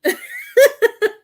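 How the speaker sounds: real laugh bursting out, high and loud; sudden loud real laughter. Real, not acted. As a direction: let a real laugh explode.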